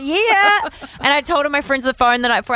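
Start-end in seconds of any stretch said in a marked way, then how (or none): 0.0-0.9 high, thrilled yeah; excited. Retold or imitated. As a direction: squeal a proud yes. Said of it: "So I was like".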